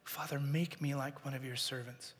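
hushed, humbled plea to father; humble quiet plea. Acted. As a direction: beg quietly like a repentant son. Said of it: "all he can think to say is".

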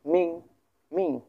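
মিং sweeping from bottom to top; teacher demonstrating rising মিং; second tone on মিং. Shown as a demonstration. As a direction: model মিং with an upward glide. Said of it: "নিচ থেকে উপরে".

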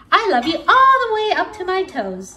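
fast and loud, upside down and giggly; little hare showing off to Big Nut Brown Hare, excited. A narrator's reading. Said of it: "he said".

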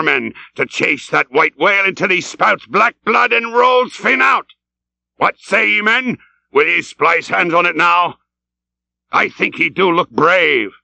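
high, fervent captain's tirade; shouted vow. Acted. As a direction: hurl out a vengeful oath to the crew. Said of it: "he shouted".

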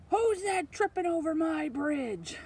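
loud, high, drawn-out troll shout; troll roaring a challenge; mean troll. Acted. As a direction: bellow slowly as a menacing troll. Troll challenges the middle goat.

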